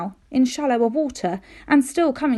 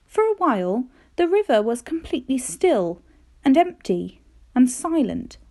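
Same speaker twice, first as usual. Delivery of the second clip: ordinary delivery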